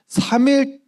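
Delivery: 삼 일 jumped up high in pitch; stress on three days. Real, not acted. Preacher stressing to the children how long the search took, 삼 일 high. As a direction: lift 삼 일 right up to stress it.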